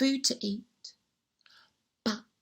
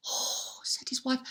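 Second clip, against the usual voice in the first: whispered oh; 11 dB quieter than usual. Acted. Wife hushed sympathy.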